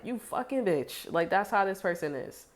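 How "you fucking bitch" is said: high, slow mock snarl; mock insult. Hothead cursing out a partner, hammed-up and high. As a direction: spit it out like a hothead.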